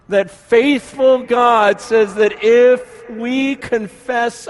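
impassioned preacher pleading with the congregation; preaching at full force. Acted. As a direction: press the question hard from the pulpit. Preaching at rising intensity.

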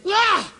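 short high mock cry; man crying out as the demon leaves; imitated cry. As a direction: yelp it sharply. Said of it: "the guy goes".

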